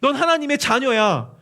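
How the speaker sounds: loud, high, insistent argument; arguing advocate. Acted. Holy Spirit to the believer about being a child, insistent. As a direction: argue the child's case with full force. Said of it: "끊임없이 논쟁하며 이렇게 얘기합니다".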